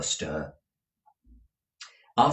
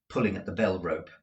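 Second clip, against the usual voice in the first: plain reading voice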